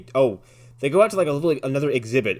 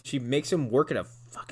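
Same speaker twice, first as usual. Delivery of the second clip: ordinary talk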